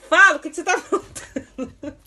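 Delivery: loud demand breaking into laughter; laughing yell. Real, not acted. Mom yells at son while starting to laugh. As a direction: yell but crack up.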